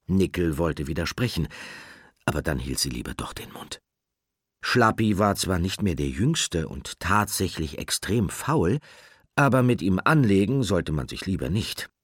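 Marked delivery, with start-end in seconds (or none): none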